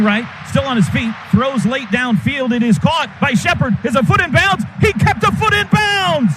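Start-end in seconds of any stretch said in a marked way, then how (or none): 2.5-6.3 excited rising call. Real, not acted. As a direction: voice climbs to a high shout on the catch. Catch on the sideline, rising call.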